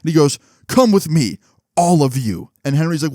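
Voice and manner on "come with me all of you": raised, higher-pitched mock-principal command; stern order. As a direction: order them out like a strict principal. Principal retold catching boys.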